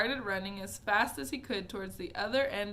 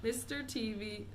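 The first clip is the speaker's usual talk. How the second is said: plain reading voice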